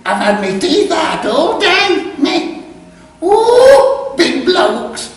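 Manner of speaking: hammed-up high, loud camp old man; Jasper's voice. Jasper boasting to the narrator, high and loud. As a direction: squeal it out, camp and proud.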